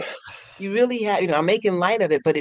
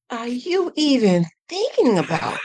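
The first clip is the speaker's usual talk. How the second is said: higher, slow, baffled question to her own mind; mock disbelief; 6 semitones higher than usual. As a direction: ask your thoughts in playful disbelief.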